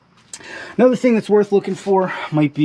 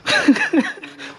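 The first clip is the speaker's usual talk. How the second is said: breathy laugh; 12 semitones higher than usual. Real, not acted.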